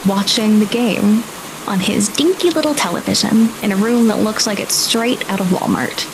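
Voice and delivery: usual speaking voice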